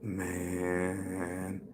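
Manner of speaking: explaining the pancake to his buddy; dazed stoner. Retold or imitated. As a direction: drag the words out slowly. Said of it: "They're like".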